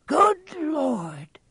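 high, amazed gasp of words; astonished old bartender. Acted. Bartender recognising Harry, high and amazed. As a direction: let it catch in surprise.

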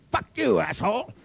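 high-pitched, gleeful mock outburst; emphatic retold insult. Patient finally insulting the man in the lab jacket, emphatic. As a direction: let the insult fly, pitched well up. Patient gotta do it right, full force.

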